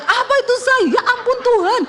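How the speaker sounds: high-pitched panicked shriek, retold; alarmed yell. Wife yelling to husband as the car rattles, panicked shout. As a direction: cry out in comic panic. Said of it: "Saya teriak".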